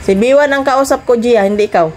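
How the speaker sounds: ordinary talk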